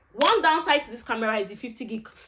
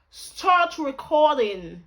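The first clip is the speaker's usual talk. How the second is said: slow, loud, higher put-on British accent; 5 semitones higher, 8 dB louder, much slower than usual. Shown as a demonstration. Commanding the camera to record, British accent. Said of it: "When I use British accent".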